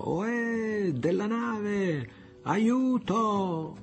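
high, long-drawn hail across the water; thunderous call. A narrator's reading. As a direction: hail a distant ship for help, stretching each word. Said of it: "con voce tuonante gridò".

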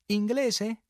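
high, loud, eager question; all smiles. A narrator's reading. Harlequin to captain hoping for a countryman, high smiling question. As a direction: ask it brightly, with a smile. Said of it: "domandò tutto sorrisi".

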